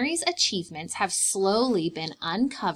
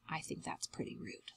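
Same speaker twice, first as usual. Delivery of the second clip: whisper; 18 dB quieter, faster than usual. Real, not acted. Confiding aside to listeners.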